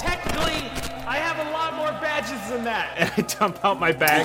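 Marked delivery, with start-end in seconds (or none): 0.0-3.0 smug correction. Acted. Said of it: "Scud grabs the microphone and goes".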